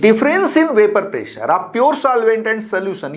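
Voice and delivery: slow, even read-aloud for note taking; dictation reading. Acted. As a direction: lay each word down carefully. Teacher reads definition slowly.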